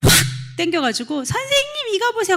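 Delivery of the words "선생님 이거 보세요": put-on high childish call to the teacher; squeaky kid voice tugging for attention. Child eagerly calling the teacher.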